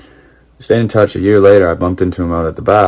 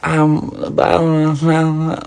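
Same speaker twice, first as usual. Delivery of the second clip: mimicking her flustered, slow, higher stammer; stammering excuse; 9 semitones higher, slower than usual. Cheating wife caught by her husband, stammering an excuse. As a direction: play her stalling for an excuse, drawn out and high. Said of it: "just trying to make some excuse".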